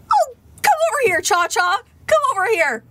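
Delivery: high, fast, coaxing dad voice; imitating eager dad. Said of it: "he'd be like".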